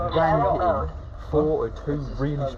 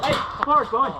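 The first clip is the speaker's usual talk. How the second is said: shouting; 10 semitones higher, 5 dB louder than usual. Real, not acted. Calling out under fire.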